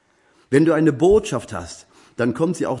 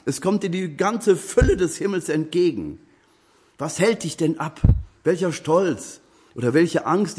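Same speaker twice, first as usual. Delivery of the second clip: fervent appeal; 6 semitones higher than usual. Acted. Preacher pleads with listeners to trust God, fervent and raised. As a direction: urge the flock to trust like children.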